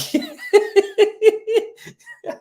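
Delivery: high, loud burst of real laughter. Real, not acted. Joking to viewers that he is getting big too, bursting out laughing. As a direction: crack up high and loud at your own joke. Laughs at own fat joke.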